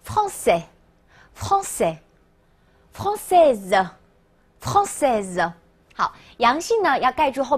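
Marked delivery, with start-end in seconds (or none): 0.0-5.5 very slow, spaced model pronunciation; repeat-after-me drill. Shown as a demonstration. Teacher has class read "French person", very slow drill. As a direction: draw out each word, pausing for learners to echo. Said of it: "好，大家跟我读啊".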